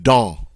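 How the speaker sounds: "Don" with a nasal vowel and silent N; French nasal vowel. Shown as a demonstration. Teacher repeats the example word, nasal vowel, silent ending. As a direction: say "Don" without closing on the N. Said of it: "I don't say the N or the S".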